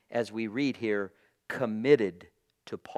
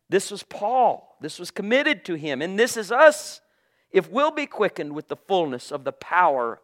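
louder, higher, fervent preaching; preaching at full force; 6 semitones higher, 8 dB louder than usual. Acted. Preacher stirring brothers and sisters, full-force fervour. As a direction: drive it home to the congregation.

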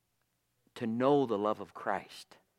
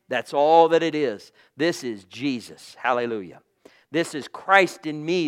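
louder, higher, fervent preaching; preaching at full force; 3 semitones higher, 8 dB louder than usual. Acted. Preacher stirring brothers and sisters, full-force fervour. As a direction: drive it home to the congregation.

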